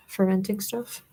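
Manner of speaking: ordinary talk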